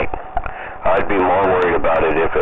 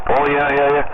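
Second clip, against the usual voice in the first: mimicking sheepish workers; 9 semitones higher than usual.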